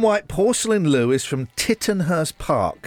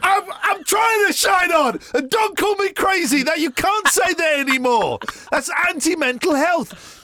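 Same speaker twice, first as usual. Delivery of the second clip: mock-offended shout; 12 semitones higher than usual. Real, not acted. Host mock-offended at song title.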